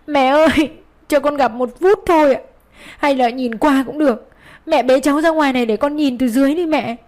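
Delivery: put-on mother wailing up to her mother-in-law to let her see her child; crying out. A narrator's reading. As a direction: cry out to her through tears. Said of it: "vừa khóc vừa gào lên".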